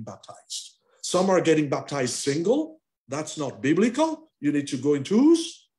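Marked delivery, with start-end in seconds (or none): none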